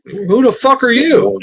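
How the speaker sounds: high, slow, drawn-out challenge; mock confrontation. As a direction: demand it of the intruder on your land. Confronting a trespassing park ranger.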